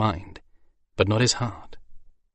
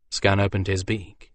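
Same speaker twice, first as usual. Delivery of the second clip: ordinary delivery, nothing marked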